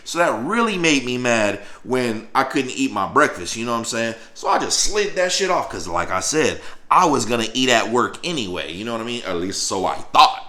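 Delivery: ordinary talk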